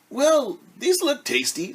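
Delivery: high, delighted hen voice; pleased hen. Acted. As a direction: savour the bugs like a happy hen.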